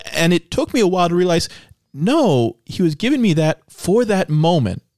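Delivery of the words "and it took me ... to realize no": ordinary talk, nothing marked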